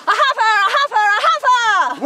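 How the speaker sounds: shout. Acted. Ruth tries the harvest cry, loud high shout. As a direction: yell it across the field. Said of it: "You wanna try that?".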